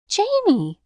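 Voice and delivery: very high, loud shocked gasp; a girl gasping at a friend's refusal; gasped name. A narrator's reading. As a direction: cry out his name in shock. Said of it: "gasped Pollyanna".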